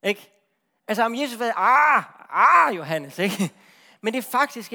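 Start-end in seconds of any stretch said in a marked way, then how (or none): 1.6-3.2 hammed-up exasperated sigh; mock disappointment. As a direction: groan it out like a let-down teacher. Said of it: "en lille smule skuffet".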